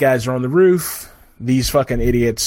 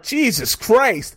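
high, slow, drawn-out disbelief; mock horrified gasp of a name; shocked exclamation; 9 semitones higher, much slower than usual. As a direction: stretch it out, higher, appalled. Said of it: "you're like".

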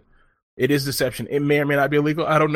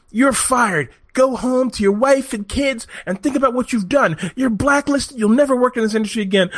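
raised, stern mock firing; mock boss firing; 9 semitones higher than usual. Boss firing the actor before the panel, mock stern. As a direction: throw him out like a furious boss. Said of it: "the boss says".

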